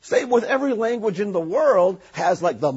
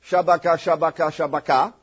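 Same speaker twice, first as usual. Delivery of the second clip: mock tongues. Shown as a demonstration. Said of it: "I'm gonna give you an example".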